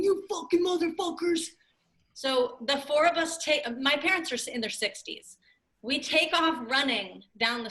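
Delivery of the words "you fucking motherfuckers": higher-pitched mock outraged yell; imitated angry neighbor. Guy in the house catching TP'ers, mock angry yell. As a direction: curse them out as the furious homeowner. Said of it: "we hear some guy in the house going".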